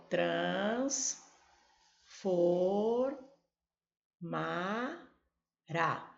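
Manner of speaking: transformará drawn out very slowly, each syllable apart. Shown as a demonstration. Teacher answering the syllable-split question. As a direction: split transformará into slow beats. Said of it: "Qual a separação de sílaba?".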